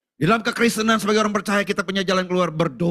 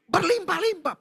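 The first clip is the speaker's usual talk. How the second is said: fervent cry; 9 semitones higher than usual. Acted. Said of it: "Yabes berseru".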